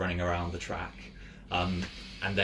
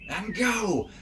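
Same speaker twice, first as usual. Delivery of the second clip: high, loud race start call; starter's shout; 14 semitones higher, 5 dB louder than usual. Acted. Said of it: "he goes".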